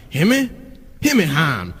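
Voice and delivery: imitated slurred catchphrase, questioning then answering; [name]'s lingo. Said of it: "his lingo at first".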